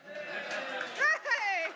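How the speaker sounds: high, laughing hey; delighted whoop and laugh; delighted cheer. Real, not acted. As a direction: cheer the winner and crack up.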